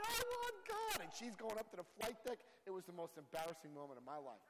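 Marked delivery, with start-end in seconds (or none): 0.0-1.0 high, loud desperate cry; screaming. Retold or imitated. To the flight attendant, a high, loud desperate cry. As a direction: cry it out, pleading.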